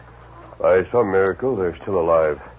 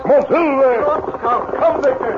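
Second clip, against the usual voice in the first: high, drawn-out call; rally the horses to a gallop; slower than usual. Acted. Masked man calling the horses to the scene of the shots.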